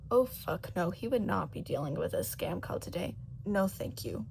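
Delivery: usual speaking voice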